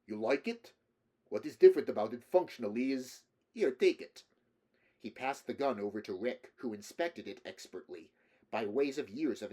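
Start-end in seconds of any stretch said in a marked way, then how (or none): 0.0-4.2 put-on loud, eager Slavic-accented patter. A narrator's reading. Russian man hands Rick the gun, loud Slavic accent. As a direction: press it on him in a Slavic accent. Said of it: "the man asked with a Slavic accent".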